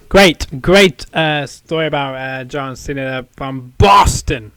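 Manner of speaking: put-on loud, slow Boston accent; hammed-up Boston accent. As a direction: lay the Boston accent on thick. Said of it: "I always love doing that, in that accent".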